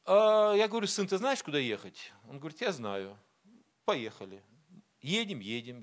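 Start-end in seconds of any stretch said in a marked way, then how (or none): none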